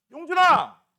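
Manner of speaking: loud, high call to a student. Real, not acted. Teacher calling on a student by name, called out loudly. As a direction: call the name out sharply across the class.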